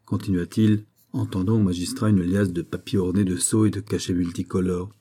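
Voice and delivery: ordinary delivery, nothing marked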